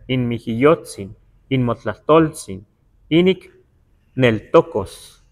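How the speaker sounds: leído despacio, sílaba por sílaba, como texto antiguo en náhuatl; lectura en náhuatl. Shown as a demonstration. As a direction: pronuncia cada palabra náhuatl con cuidado, sin prisa. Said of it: "Más o menos así sonaba". (náhuatl leído en voz alta, lento y claro)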